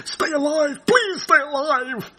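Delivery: high, drawn-out mock plea; mock pleading. They'd be just begging someone to stay alive, mock pleading.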